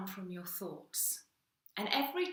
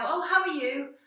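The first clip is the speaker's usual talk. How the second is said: bright, raised voice, a chirpy friend asking; mock cheery greeting; 7 semitones higher, 8 dB louder than usual. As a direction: greet like an upbeat acquaintance. Friend asks cheerily how you are.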